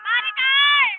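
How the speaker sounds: loud shouted name; calling out. Real, not acted. Friend calling out to Maricar, loud shout. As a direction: holler the name.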